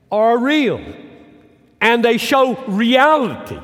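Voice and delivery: high, loud, slow proclamation; preaching at full force. Acted. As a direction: cry it out to the congregation. Preaching climax.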